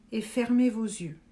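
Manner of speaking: unhurried, measured phrases that leave room to breathe; guided meditation. Acted.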